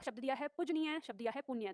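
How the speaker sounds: ordinary talk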